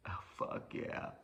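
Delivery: slow, whispered gloat; hushed self-congratulation. Retold or imitated. Looking at himself in the mirror, whispered triumph. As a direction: savour it under your breath, drawn out.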